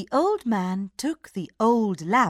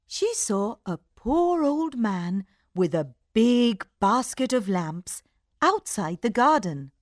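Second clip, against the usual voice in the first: ordinary delivery, nothing marked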